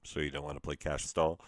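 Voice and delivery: low, loud, unfazed game-show host; imitated prankster host. Host answering the man's threat, imitated low and unfazed. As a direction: drop low for the host's unfazed comeback.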